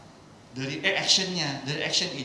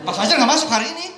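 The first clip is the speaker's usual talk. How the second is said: loud, much higher angry shout; 10 semitones higher, 11 dB louder, faster than usual. Shown as a demonstration. Trainer demonstrating an angry tone to class, fast furious shout. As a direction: snap it out fast like you're furious. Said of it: "Sekarang pakai nada marah".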